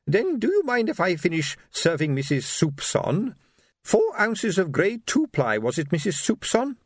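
high little shopkeeper voice, polite; querulous old lady. Acted. Old shopkeeper serving Missus Soupson, querulous and polite.

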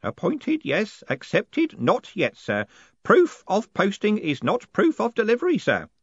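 put-on high-pitched, pedantic old underling; fussy old postman voice; Groat, officious old postman. A narrator's reading. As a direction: recite the rules high and deferentially.

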